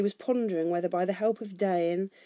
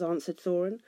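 ordinary delivery

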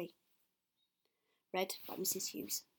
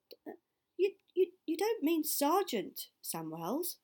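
stammering, pitched up and loud with shock; gasped; 7 semitones higher, 4 dB louder than usual. Acted. A boy gasping in disbelief at his father's plan, higher and louder. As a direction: gasp it out, stumbling over the words. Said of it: "I gasped".

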